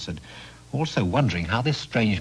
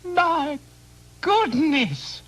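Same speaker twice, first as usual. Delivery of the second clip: very high, slow wailing cry; high sobbing shout of a distraught mother; slower than usual. Acted. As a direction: she despairs at her fool of a son. Mother wails at the foolish trade.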